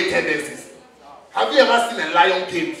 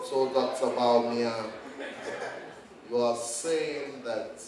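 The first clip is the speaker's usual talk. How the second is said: drowsy, dragged-out words, lower and softer; sleepy slow Bawumia; 8 semitones lower, 12 dB quieter, slower than usual. Retold or imitated. Comedian mocking Bawumia's drowsy slow speech. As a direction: sound like he just woke up. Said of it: "Bawumia always talks like he just woke up from sleep".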